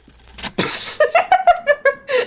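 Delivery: high gleeful giggle; giggling laugh. Real, not acted. Laughs at escape.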